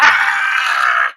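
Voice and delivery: a deep harsh eagle screech, shouted out; eagle cry. Retold or imitated. Eagle jumping out of the nest, loud screech. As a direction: let out the eagle's cry as it leaps. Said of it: "And that's the eagle sound".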